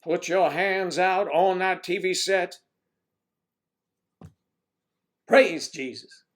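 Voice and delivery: mock preacher urging the viewers to touch the screen. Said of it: "I would say".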